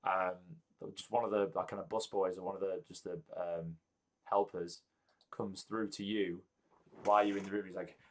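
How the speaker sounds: ordinary talk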